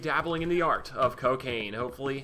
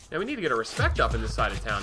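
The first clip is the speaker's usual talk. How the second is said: normal talking voice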